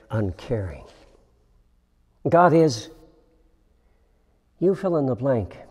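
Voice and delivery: ordinary talk, nothing marked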